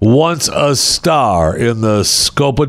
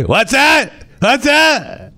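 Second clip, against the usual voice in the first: very high squeaky querying; mock incomprehension; much slower than usual. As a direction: squeal it as if you can't hear. Pretends not to hear the computer.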